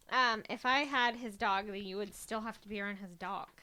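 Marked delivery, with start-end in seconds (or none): none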